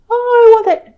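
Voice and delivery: put-on high, loud childish whine; whiny spoilt child. Spoilt child in the shop demanding, high whine. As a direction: whine like a child denied sweets. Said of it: "a spoilt child".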